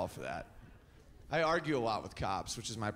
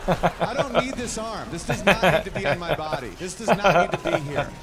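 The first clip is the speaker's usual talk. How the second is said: loud comic rant; 10 dB louder than usual. Acted. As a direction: shout it through the laughter, hammed up. Comic rant about spare arm.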